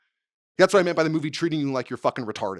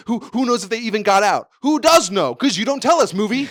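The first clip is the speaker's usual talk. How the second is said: higher and louder, demanding answers; indignant rant; 8 semitones higher, 9 dB louder than usual. Real, not acted. Ranting at the movie for dropping two characters, loud and high. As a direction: call out the movie like it owes you.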